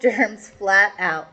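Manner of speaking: plain reading voice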